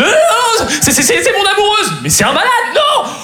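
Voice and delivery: very high, stammering mock panic; panicked protest. Boyfriend pleading with chasing agents, high acted panic. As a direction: protest frantically, voice shooting up.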